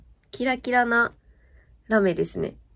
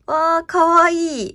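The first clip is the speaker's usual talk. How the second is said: delighted squeal; 6 semitones higher, 8 dB louder, slower than usual. Real, not acted. Delighted by the written sample.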